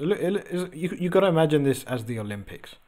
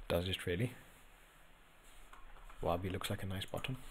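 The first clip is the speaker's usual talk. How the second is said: much softer and lower, to yourself; hushed, pondering repeat; doubtful musing; 6 semitones lower, 15 dB quieter than usual. Real, not acted. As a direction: quietly mull over the claim. Repeats comment to himself, doubting.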